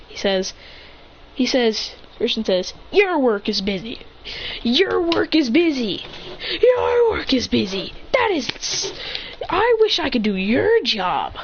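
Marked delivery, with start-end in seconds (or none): 2.9-11.3 repeated in rising, stretched disbelief; incredulous echo. Acted. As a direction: throw the words back, higher and slower. Character echoes complaint about busy work.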